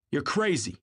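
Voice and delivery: high, angry outburst, not shouted; exploding. A narrator's reading. Tom rejecting Gatsby's story, exploding. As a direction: burst out indignantly, pitch jumping up. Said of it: "he exploded".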